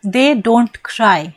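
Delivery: very slow, word by word, as if dictating; slow read of the statement. Shown as a demonstration. Teacher dictating the statement to students, very slow. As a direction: spell out "They don't cry" slowly to the class. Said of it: "जैसा कि statement है ना".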